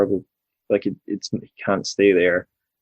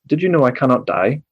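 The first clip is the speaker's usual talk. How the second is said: lightly voiced robot quote; flat mock robot delivery; Kraft Punk robot voice; 5 semitones higher than usual. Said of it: "he speaks in a robot voice".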